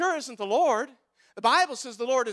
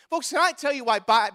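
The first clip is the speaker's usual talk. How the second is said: ordinary delivery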